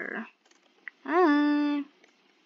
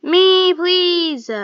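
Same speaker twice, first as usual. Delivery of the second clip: loud pleading; 7 semitones higher, 12 dB louder than usual. Real, not acted. Begging to be picked.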